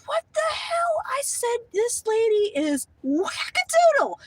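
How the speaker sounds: high, slow what the hell, this lady is wackadoodle; incredulous outrage. Retold or imitated. Describing the receptionist to viewers, high incredulous outrage. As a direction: pitch it up in disbelief.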